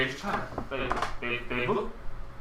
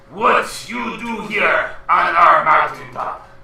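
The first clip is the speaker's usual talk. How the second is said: slow giant question; 2 semitones higher, 6 dB louder, slower than usual. Acted.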